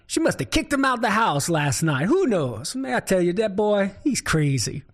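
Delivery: put-on folksy Cajun accent, musing to himself. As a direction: puzzle it over in a Cajun accent. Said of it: "Boudreaux thought to himself".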